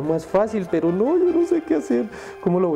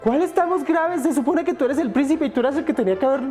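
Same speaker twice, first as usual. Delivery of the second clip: angry high princess; 7 semitones higher than usual. Acted.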